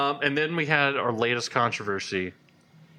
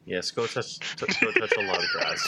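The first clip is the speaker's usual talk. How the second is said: laughing. Real, not acted. Laughing at the joke.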